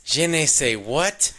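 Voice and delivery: high, slow mangled French; hammed-up confused foreigner; clueless mock French. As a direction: stretch it out like a lost tourist. S0 repeats the clueless character.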